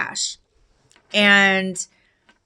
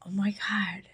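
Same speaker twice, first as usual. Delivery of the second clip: awed whisper; stunned whispered oh my God; 10 dB quieter than usual. Retold or imitated. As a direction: breathe it out in realization. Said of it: "And I go".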